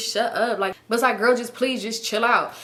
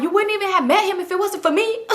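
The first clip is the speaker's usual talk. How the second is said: high-pitched mock indignation; sassy, high-voiced one-upping; retold indignant comeback; 9 semitones higher, 3 dB louder than usual. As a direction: fire back, re-enacting an argument. Acts own comeback in quarrel.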